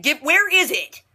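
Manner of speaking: mock exasperated outburst, high and drawn out; frantic demand. Arthur rummaging through wife's bag, imitated frantic demand.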